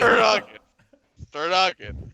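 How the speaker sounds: lightly voiced sportscaster grumble; a hint of an old football announcer; John Madden impression. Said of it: "Do a John Madden".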